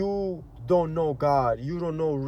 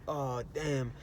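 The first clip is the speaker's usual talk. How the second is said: quiet, scornful aside about a fake guy; muttered envy; 7 dB quieter than usual. Scrolling Instagram and scoffing at a happy stranger, quietly scornful. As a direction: grumble it under your breath with mild contempt. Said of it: "And you're like".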